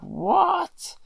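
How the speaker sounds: sudden high startled "What?"; imitating a shocked bloke. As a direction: jump up in pitch, caught off guard. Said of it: "and he's like".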